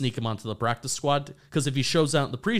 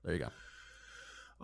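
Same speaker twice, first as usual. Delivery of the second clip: whispered; 7 semitones lower, 16 dB quieter, much slower than usual. Real, not acted. Wrapping up his pick.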